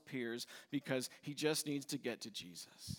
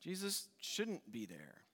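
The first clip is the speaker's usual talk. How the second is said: ordinary talk, nothing marked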